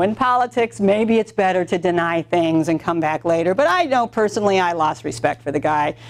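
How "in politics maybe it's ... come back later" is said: ordinary talk, nothing marked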